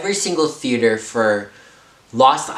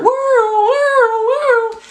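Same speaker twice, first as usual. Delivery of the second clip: siren imitation; 5 dB louder, much slower than usual. Imitating fire truck sirens.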